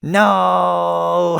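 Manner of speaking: high, loud, sustained wail; big breath, then hold the cry; full-out drawn-out scream of denial; long dramatic no. Acted. Takes breath, cries out in scene.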